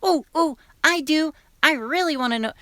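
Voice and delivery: put-on a high, eager girl's voice; eager schoolgirl. Schoolgirl Teresa eagerly volunteering, in a high voice. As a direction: beg to learn like a keen pupil. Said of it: "Teresa raised her hand and said".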